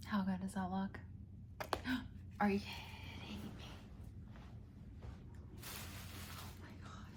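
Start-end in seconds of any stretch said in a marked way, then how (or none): 1.0-2.3 quiet delighted gasp; soft gasp. Real, not acted. Sees the food. 2.4-7.1 awed whisper. Real, not acted. Whispers in awe.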